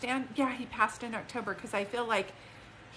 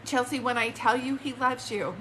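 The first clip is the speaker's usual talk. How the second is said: usual speaking voice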